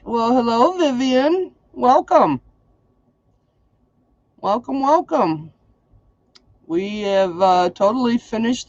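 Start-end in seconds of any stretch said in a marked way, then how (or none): 0.0-5.5 high sing-song hostess welcome. Real, not acted. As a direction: greet her up high and lilting. Greets viewer by name, sing-song.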